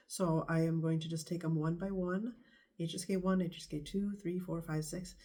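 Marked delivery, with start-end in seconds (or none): none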